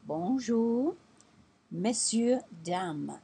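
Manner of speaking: Bonjour, messieurs, dames said in French, bright and lifted; French greeting. Shown as a demonstration. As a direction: teach the French shop greeting aloud. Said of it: "the way to do that in French is to say".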